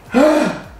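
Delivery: a sudden high gasp of mock shock; sharp gasp. Real, not acted. Contestant gasps at his own pick, high sharp gasp. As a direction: gasp loudly as if caught out. Choosing to kill Jade.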